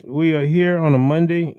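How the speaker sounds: loud, high excited cheer; big radio hello; hyped host. Real, not acted. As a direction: pump up the live audience. Radio host hyping the show.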